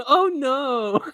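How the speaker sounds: high falsetto yelp; high imitation. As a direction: squeal it in falsetto. Imitating the clip's line.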